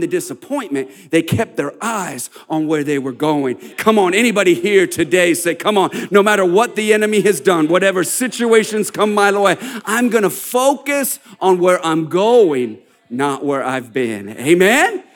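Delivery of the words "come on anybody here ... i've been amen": rousing preacher. Acted. Pastor rouses the congregation.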